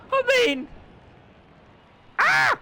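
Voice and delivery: short high yelp of fright; sudden scream. Real, not acted. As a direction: let out a startled yelp. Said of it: "that was an appropriate time to scream".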